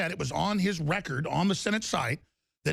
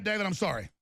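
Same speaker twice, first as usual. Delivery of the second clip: normal talking voice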